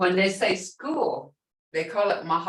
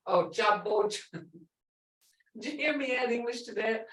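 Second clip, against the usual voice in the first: normal talking voice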